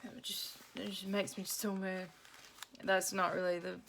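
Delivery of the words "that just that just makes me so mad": whispered anger. Retold or imitated. Son grumbling at the fridge in a hushed whisper. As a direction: whisper it like a fuming teen under his breath. Son sees the ugly tree.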